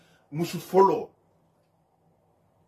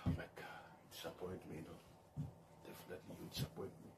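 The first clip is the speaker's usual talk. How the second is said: breathy whisper, words barely sounded; disappointed whisper; 11 semitones lower, 25 dB quieter than usual. Real, not acted. Sighing over Londo Fankaso letting him down, whispered. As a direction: let the disappointment drop to a whisper. Dismayed aside after sighing.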